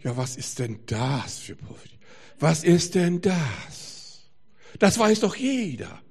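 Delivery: imitated disdain, stretched and emphatic; dismissive old-guard Christians. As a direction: scoff like a smug churchgoer who knows it all. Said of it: "Arme verschränken, zurücklehnen und sagen".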